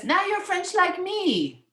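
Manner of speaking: put-on high, drawn-out boy's voice; slow, high child voice; little French boy. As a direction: proclaim it like a little storybook boy. Boy Pierre accepts Josephine.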